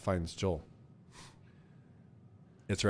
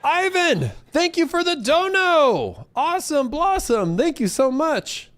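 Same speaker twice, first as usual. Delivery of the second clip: excited thanks; 6 dB louder, slower than usual. Real, not acted. Thanking donor excitedly on stream.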